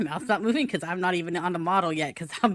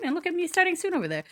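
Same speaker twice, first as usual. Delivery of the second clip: high silly voice; 8 semitones higher, faster than usual. Pretend. Cute shark intro on start screen.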